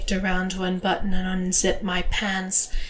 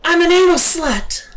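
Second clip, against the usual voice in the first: high, loud, unhesitating shout; emphatic cry, pitched way up; affirmative cry; 10 semitones higher, 8 dB louder than usual. A narrator's reading. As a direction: cry it out with conviction. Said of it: "I cried affirmatively".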